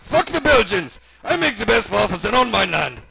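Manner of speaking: put-on loud, high German-accented rant; furious German outburst; outraged shout. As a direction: yell it like an offended German doctor. Doc's shouted outburst, red-faced.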